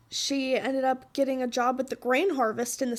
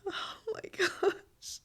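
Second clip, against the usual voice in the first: tearful whisper; 7 semitones higher, 7 dB quieter, much slower than usual. Real, not acted. Overwhelmed by debt.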